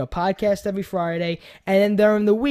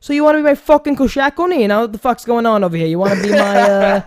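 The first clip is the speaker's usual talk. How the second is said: loud, exaggerated New York accent; New Yorker accent; 2 semitones higher, 6 dB louder than usual. Shown as a demonstration. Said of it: "just say it like a New Yorker".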